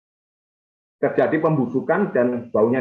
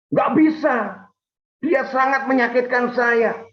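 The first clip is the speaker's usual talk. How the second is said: aggrieved protest; 7 semitones higher than usual. Acted. Hurt wife tells pastor why she cannot forgive, aggrieved. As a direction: imitate a hurt spouse protesting. Congregant refuses to forgive spouse.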